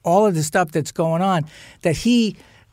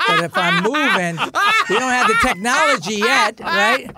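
laughing through words; 8 semitones higher than usual. Real, not acted. Laughing while telling the story.

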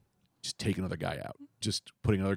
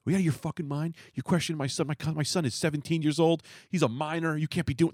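dad losing it; 6 semitones higher than usual. Retold or imitated. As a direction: imitate the father snapping at the cop, pitch up. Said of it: "like, loses it".

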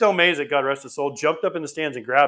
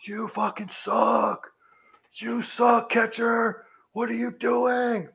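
high, drawn-out mock heckle; high-pitched jeer with stretched words; heckling teenager; 9 semitones higher, much slower than usual. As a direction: taunt the catcher like a kid in the stands. Teen heckler yelling at catcher.

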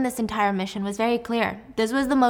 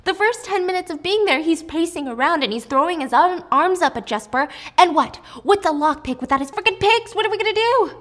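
higher, louder panicky rant building to a squeak; panicked; 8 semitones higher, 4 dB louder than usual. Retold or imitated. Wylan panicking at Jesper in the cell, higher and louder panicky whine. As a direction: play the nepo baby losing it, voice climbing. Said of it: "he's panicked".

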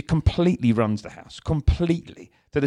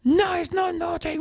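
put-on much higher, slow little-kid voice protesting; defiant toddler; slower than usual. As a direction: play the sulky two-year-old. Father voicing defiant toddler.

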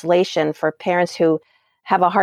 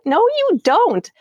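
put-on kid's retort; 11 semitones higher, slower than usual. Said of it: "'cause they feel like".

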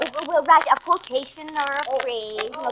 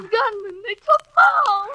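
high, slow tearful cry; 6 semitones higher than usual. Acted. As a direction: sob out the bad news. Distressed, crying.

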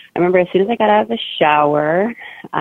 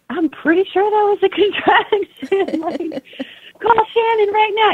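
very high, tight mock squeal; retold panic; slower than usual. Wife telling husband to call midwife, high mock panic. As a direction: squeak it, half laughing at the panic.

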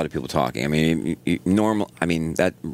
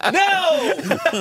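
very high, horrified yelling; retold screaming; much slower than usual. Acting out screamers at the leg-breaking scene, screaming. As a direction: cry out as if watching it happen. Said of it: "And we screamed".

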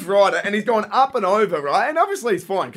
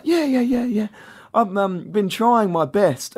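lightly voiced earnest kid, a bit higher; imitated little boy; 4 semitones higher, slower than usual. Young lad answers about the scooter, earnest kid voice. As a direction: play a small boy giving his effort. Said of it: "he's just like".

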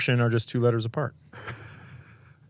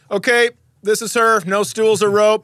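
mock store greeter; 9 semitones higher, 5 dB louder than usual. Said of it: "the welcome guy is like".

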